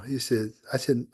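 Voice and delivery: ordinary delivery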